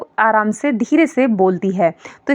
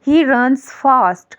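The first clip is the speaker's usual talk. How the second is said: very slow and a bit higher, word by word; slow, raised example; 4 semitones higher, much slower than usual. Shown as a demonstration. Teacher reads the example sentence aloud, very slow and raised. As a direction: lay each word down clearly for the class. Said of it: "जैसे एक sentence है".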